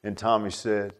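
ordinary talk, nothing marked

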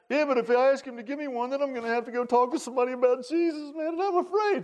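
high, whiny mock excuse; reluctant churchgoer. Pastor mimics a scared churchgoer, high whiny excuse. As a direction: play the timid member making excuses.

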